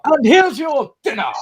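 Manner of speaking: high-pitched mock sitcom outburst; acted sitcom quote. Acting out Fawlty Towers goose-step scene.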